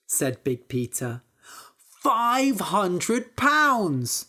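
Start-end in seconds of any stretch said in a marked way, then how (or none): 2.0-4.3 slow, drawn-out proud boast; boastful. Acted. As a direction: savor each word to impress. Peter boasts to brother.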